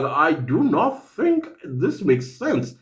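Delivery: low, slow, word-by-word objection; slow, deliberate doubt. Acted. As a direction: say it slowly and low, spelling out the doubt. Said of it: "you'll be like".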